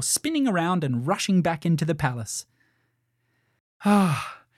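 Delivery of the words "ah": loud, high sigh of hope; huge hopeful sigh. A narrator's reading. Princess leaving the room of mirrors, sighing with hope. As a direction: let out a big relieved "ah". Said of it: "With a huge sigh of hope".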